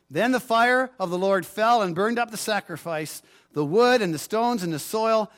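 ordinary talk, nothing marked

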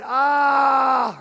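loud, high, drawn-out "ah"; groan of pain. Shown as a demonstration. Preacher imagining a dying shout, pained loud groan. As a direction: cry out on an open vowel. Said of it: "when we groan in pain".

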